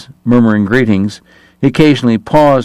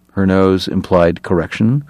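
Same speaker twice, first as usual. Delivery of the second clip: plain reading voice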